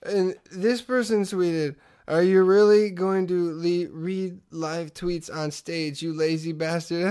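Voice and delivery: slow, drawn-out stoner reading; comic reading tweets. Acted. Doug Benson reading crowd tweets on stage, slow mocking impression. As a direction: read it out haltingly, stretching words. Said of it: "reading them going".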